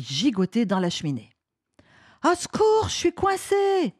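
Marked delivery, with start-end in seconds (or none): 2.2-3.9 put-on very high-pitched stuck kid; cry for help. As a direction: call for rescue, stuck and panicking. Boy stuck in chimney calls help.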